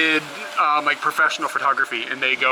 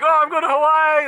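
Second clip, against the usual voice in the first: much higher, louder, gushing imitation; excited driver; 12 semitones higher, 5 dB louder than usual. Imitating the driver bragging about his Hawaii trip, very excited. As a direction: mimic the stoked driver bubbling over. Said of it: "was really excited to talk to me".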